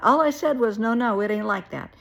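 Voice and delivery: defensive protest. Retold or imitated. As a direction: imitate him loudly defending himself. Acted defensive denial in quarrel.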